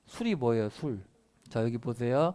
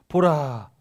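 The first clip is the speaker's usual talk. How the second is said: 보라 punched out loud; emphatic proclamation; 2 semitones higher, 9 dB louder, much slower than usual. Acted. Teacher reading scripture to students, emphatic 보라. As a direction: hit 보라 hard, one forceful burst. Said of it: "강조하고 있어요".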